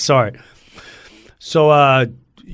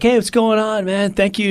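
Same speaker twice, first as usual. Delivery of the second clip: higher-pitched, chummy hello; upbeat, raised voice; retold eager greeting; 8 semitones higher than usual. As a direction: greet him like an eager fan, high. Greeting agent in retold story.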